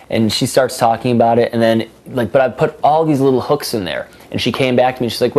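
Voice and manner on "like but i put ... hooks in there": ordinary delivery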